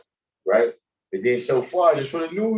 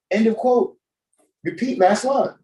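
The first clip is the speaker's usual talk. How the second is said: mock earnest Biden; 10 semitones higher than usual. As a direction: read the cue line with fake conviction, pitched up. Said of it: "He said this shit like he meant it".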